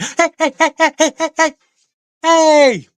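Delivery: high, loud hyped-up calls; excited yelling. Pretend. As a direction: jump around and hype them up. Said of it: "to make you feel more excited".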